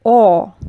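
O as a full clear open vowel. Shown as a demonstration. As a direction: say O round and distinct. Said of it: "we will have a clear".

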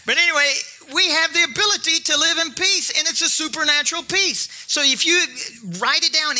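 high, driving sermon delivery; full-force preaching. Real, not acted. Preacher urging the congregation to take courage, forceful. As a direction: preach it with rising conviction.

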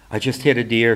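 usual speaking voice

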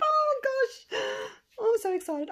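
high, loud overwhelmed squeal; real thrilled squeal; so excited. Real, not acted. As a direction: gush with high-pitched delight.

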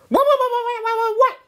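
very high, loud squeaky voice repeating in alarm; a tiny toy creature freaking out; squeaky panicked character. Acted. Toy character panicking at the story's twist, squeaky high shout.

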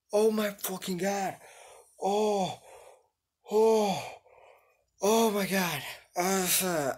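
high, loud drawn-out despair; despairing groans. Real, not acted. Streamer reeling after the fall, loud despairing groans. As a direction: let the loss hit you out loud.

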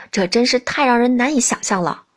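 ordinary delivery

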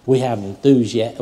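ordinary talk, nothing marked

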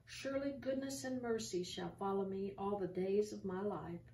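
ordinary talk, nothing marked

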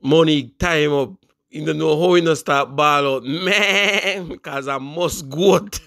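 ordinary talk

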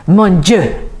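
exasperated French exclamation; angry outburst. A narrator's reading. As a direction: burst in with mild theatrical outrage. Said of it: "glared angrily at them".

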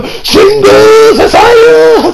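high, loud, drawn-out hammed-up yell; mock battle cry. Vlogger screaming the soldiers' battle cry, high and slow. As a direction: belt the cry like a charging soldier.

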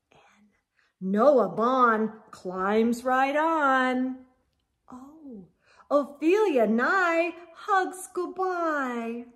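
plain reading voice

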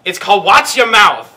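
loud announcing. Acted. Announcing the game name.

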